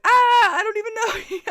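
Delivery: high-pitched and laughing; laughing disbelief. Retold or imitated. Mobbed by cheering strangers, high laughing disbelief. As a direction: laugh helplessly through it.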